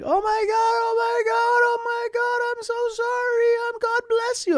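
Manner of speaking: very high, loud mock panic; freaking-out Balinese mom. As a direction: imitate a hysterical apologizing mother in falsetto. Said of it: "she's freaking out".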